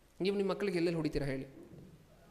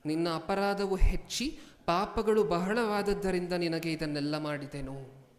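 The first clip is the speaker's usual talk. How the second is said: slow, emphatic Bible declamation; solemn scripture reading; 3 semitones higher, 5 dB louder, slower than usual. Acted. Preacher reads God's verdict to the congregation, slow and emphatic.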